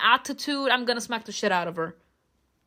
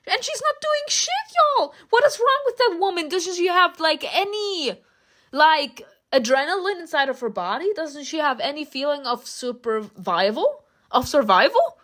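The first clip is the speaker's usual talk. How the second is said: exasperated rant; 7 semitones higher than usual. Real, not acted. Player venting at the heroine to viewers, high-pitched exasperation. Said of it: "she doesn't do shit".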